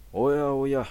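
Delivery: put-on high amused old man's "oh my"; creaky wise old man. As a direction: chuckle it off like an unbothered elder. Priest unfazed by knife.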